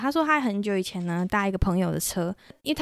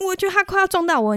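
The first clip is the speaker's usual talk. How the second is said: scared; 10 semitones higher, 4 dB louder, faster than usual. Retold or imitated. Scared of crossing cars.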